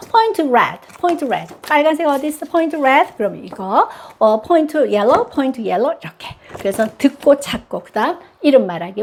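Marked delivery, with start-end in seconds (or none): none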